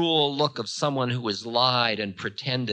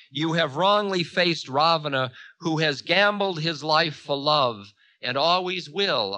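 lightly raised, proud declamation; Ravana, proud; 6 semitones higher, 6 dB louder than usual. A narrator's reading. Ravana declaring himself a gambler for love, proud. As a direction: declaim it firmly, a little high.

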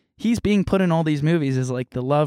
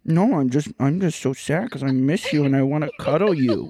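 put-on baby talk. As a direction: coo like a clingy toddler. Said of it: "they're not baby talkers".

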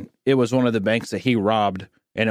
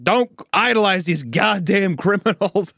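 grandpa's gruff scolding, higher and slow; stern grandpa; 7 semitones higher than usual. Retold or imitated. Grandfather scolding his grandson about Jesse James, in a slow mock-gruff voice. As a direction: scold like an old man fed up with outlaws. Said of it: "He's like".

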